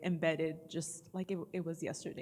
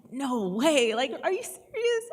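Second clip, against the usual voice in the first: loud and high, thrilled surprise; excited disbelief; 5 semitones higher, 10 dB louder than usual. Retold or imitated. Mother retelling her thrilled reaction to son's role, loud and high. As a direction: react like you can't believe the news.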